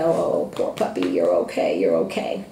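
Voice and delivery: put-on hushed, breathy baby talk to a scared puppy; coddling mommy. Mommy coddling her frightened puppy in a hushed coo. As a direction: soothe the pup in a soft, cooing murmur. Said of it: "our first instinct is to automatically pick up the dog and coddle it and go".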